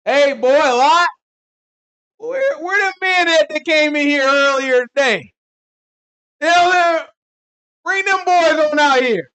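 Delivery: loud, high, slow Southern country drawl; hollering like rowdy country townsmen; country accent. Retold or imitated. Townsmen yelling at Lot in a country accent. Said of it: "I like to picture 'em with country accent".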